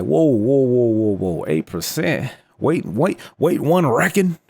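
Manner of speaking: loud, high, hammed-up Texas drawl; horrible Texas accent. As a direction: do a bad cartoonish Texan, incredulous and slow. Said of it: "That's my horrible Texas, uh, accent".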